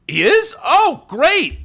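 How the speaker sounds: high, loud burst of happy surprise; delighted shock. Real, not acted. Streamer hearing from chat that Havoc is on, delighted shock. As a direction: exclaim it as thrilling, unexpected news. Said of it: "I'm so shocked".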